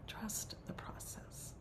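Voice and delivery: very soft, slow, near-whisper; hushed reassurance. Real, not acted. Teacher repeats counsel to the circle, hushed reassurance. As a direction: let it sink to a hushed echo.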